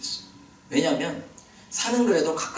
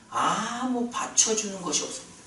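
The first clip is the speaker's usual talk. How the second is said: ordinary talk, nothing marked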